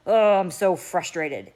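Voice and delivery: loud, drawn-out show of frustration; exaggerated exasperated outburst; frustrated. Shown as a demonstration. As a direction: model frustration for a toddler, big and slow. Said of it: "when I get frustrated".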